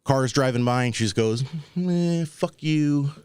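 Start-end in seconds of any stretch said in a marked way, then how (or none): 1.8-3.1 a slow, drawn-out, higher mock of her "fuck you"; imitating homeless woman. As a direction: lazily drag out the insult like she can't be bothered. Homeless woman curses passing cars.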